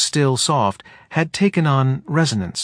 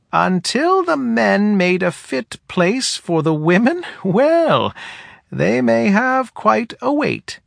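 put-on higher, dry old woman; wry, higher elder woman voice; head woman voice; 10 semitones higher, slower than usual. A narrator's reading.

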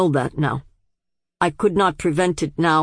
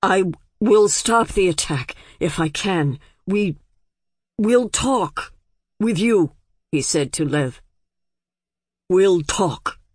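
struggling for control. A narrator's reading. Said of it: "he struggled for control".

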